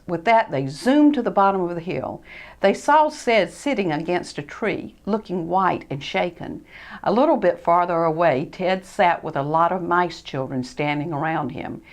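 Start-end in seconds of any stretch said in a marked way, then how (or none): none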